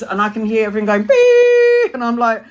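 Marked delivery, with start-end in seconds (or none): 1.0-1.9 high loud held beep; imitated beep. Teller mimicking the heart monitor with a loud high beep. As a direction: mimic a machine beeping, high and steady.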